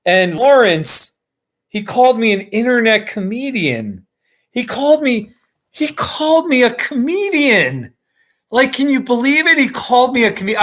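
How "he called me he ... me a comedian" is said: excited. Real, not acted. Thrilled at being called comedian.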